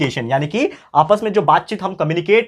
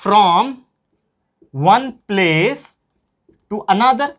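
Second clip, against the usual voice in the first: slow dictation; 2 semitones higher, much slower than usual. Shown as a demonstration. Dictation with long pauses.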